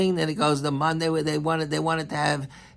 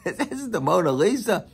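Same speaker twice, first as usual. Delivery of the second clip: scoffing visitor; 6 semitones higher than usual. Retold or imitated. Second museum visitor mocking the Mona Lisa, scornful. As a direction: brush off the painting with a laugh. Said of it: "another person says".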